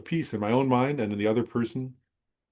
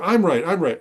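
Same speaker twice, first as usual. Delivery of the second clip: higher, louder imitation of a heated insistence; mild mock quarrel, raised and insistent; 7 semitones higher, 5 dB louder than usual. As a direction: play the stubborn arguer, lightly. Two Tibetans quarrelling, retold.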